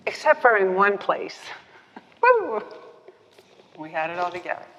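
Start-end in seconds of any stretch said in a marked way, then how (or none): 2.2-2.6 whoop. Real, not acted. Laughing whoop about husband.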